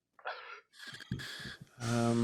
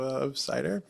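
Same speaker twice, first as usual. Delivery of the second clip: ordinary talk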